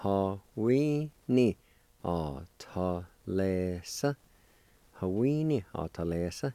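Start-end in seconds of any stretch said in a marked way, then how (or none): 0.0-4.1 measured teaching pronunciation; syllable-by-syllable demonstration. Shown as a demonstration. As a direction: separate syllables with gaps, last one lifted. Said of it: "And together".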